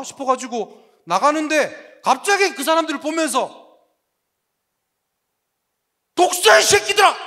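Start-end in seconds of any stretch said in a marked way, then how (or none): 6.1-7.1 high, loud roar of contempt; a prophet's loud furious rebuke; furious prophet shout. Retold or imitated. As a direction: hurl the insult at the crowd. John cursing the Pharisees.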